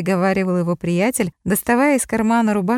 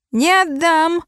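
shrill refusal; 9 semitones higher, slower than usual. A narrator's reading. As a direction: yell it, clutching the bottle. Drunk clutching bottle refuses.

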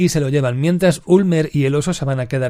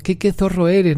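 mock-sweet Landa; 7 semitones higher than usual. Landa greeting the actress with exaggerated charm, mock-sweet. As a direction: purr the compliment like a fake charmer.

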